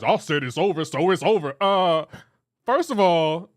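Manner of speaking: loud, mock-stern dad voice. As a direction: imitate a dad laying down the law, loudly. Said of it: "try to use his dad voice".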